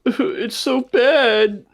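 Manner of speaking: high, very slow whine; whiny developer. Retold or imitated. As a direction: drag it out like a sore loser. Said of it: "game developers are like".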